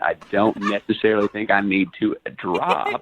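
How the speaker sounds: swooping, self-assured impression; Matthew McConaughey. Caller defends the voice to the hosts, doing McConaughey. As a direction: refuse to drop the McConaughey act. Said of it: "you have to drop it".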